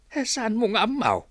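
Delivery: a gossip's astonished question; surprised. Acted. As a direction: act amazed at her not knowing. Neighbor surprised she doesn't know.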